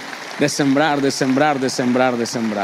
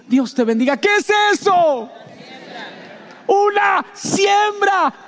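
full-force preaching; 10 semitones higher than usual. Acted. Preaching peak, crowd call-and-response. (predicación a gritos, lenta y aguda)

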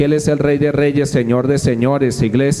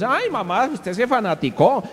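high, swooping whine of complaint; mock exasperated son; 9 semitones higher than usual. Son telling mother she became a fanatic, mock exasperation. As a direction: imitate a son scolding his mother, pitched up.

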